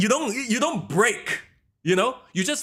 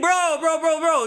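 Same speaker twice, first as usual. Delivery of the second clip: urgent protest; 13 semitones higher, 4 dB louder than usual. Real, not acted. Interrupting excited co-host.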